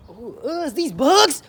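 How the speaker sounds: high, loud squeal of disgust; bug freak-out. Real, not acted. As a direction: build from grossed-out noise to yell. Freaking out at bugs.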